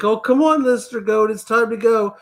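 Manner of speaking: high sweet coaxing voice, like talking to a pet; wife coaxing a stage-frightened goat, high and sweet. Retold or imitated. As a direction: sweet-talk a stubborn animal. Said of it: "she gets down in the goat's face and it's like".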